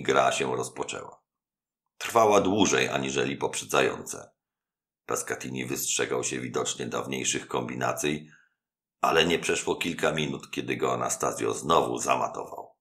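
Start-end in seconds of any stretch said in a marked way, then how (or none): none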